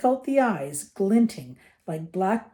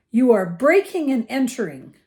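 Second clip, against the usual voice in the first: raised, loud outrage; indignant accusation; 4 semitones higher, 6 dB louder than usual. A narrator's reading. Uncle tells the giant he is trespassing, indignant.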